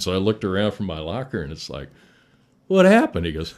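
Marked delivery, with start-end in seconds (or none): 2.7-3.2 high, loud mock-innocent question; feigned innocence. Prankster asking the victim, high loud mock innocence. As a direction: play dumb, loud and high.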